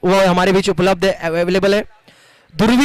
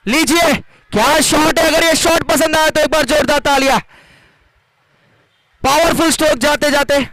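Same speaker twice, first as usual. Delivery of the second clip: excited; 6 semitones higher than usual. Real, not acted. Commentator hails a big shot.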